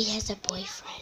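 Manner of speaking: hushed secret whisper. Real, not acted. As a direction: whisper it so nobody hears. Whispering secret about sister.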